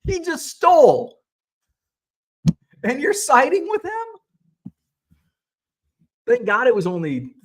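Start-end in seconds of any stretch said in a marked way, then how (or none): 0.0-4.7 way higher and louder, incredulous yelling; slowing, stunned fury at eBay siding with a thief; outraged disbelief. Retold or imitated. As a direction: rant at eBay in outraged shock. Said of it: "I'm like".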